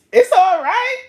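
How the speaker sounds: very high, sweet coaxing voice; high sweet-talking sugar devil. Retold or imitated. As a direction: flatter her like a tempting devil. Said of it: "Talking about".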